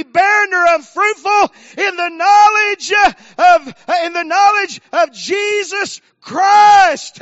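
shouted, high, drawn-out preacher cadence; full-force preaching. Real, not acted. Preacher telling church God wants it to thrive, shouted preaching. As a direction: shout each phrase out, punched with "uh".

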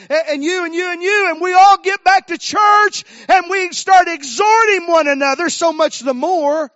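loud, building preacher's exhortation; full-force preaching. Real, not acted. Preacher rousing the church to gather and exhort, fired-up preaching. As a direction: build it up into a shout.

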